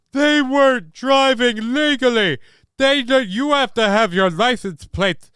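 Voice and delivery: high, mocking indignant voice; high mock-scandalized voice; mock outrage. Mock outrage at plateless car.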